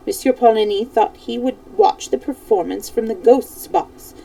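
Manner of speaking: usual speaking voice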